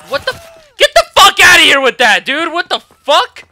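loud, high angry outburst; furious yelling. Real, not acted. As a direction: scream in rage at the game. Killed, rages at game.

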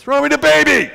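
full-out high shout; urgent yell. Retold or imitated. Football player yelling to a fleeing mother, urgent yell. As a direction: yell it urgently across a distance. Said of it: "and he yells at her".

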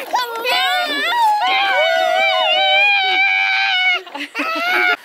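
a high, loud burst of laughter; shrieking laugh. Real, not acted. Mom bursts out laughing at kid.

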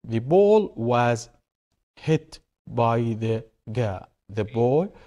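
very slow, word-by-word example sentence; slow reading aloud. Shown as a demonstration. Teacher reading a passive example to the class, slow and deliberate. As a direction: spell the sentence out slowly, with space between words.

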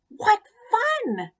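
high, bright squeal of delight; a tiny tadpole thrilled to bits; high-pitched gleeful cry; excited pollywog. Acted.

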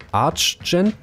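Archgent as "Atch-ent", rising high into a question; struggling pronunciation. Shown as a demonstration. Streamer failing at an Albanian name, questioning high "Atch-ent" for Archgent. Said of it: "ich kann das nicht aussprechen".

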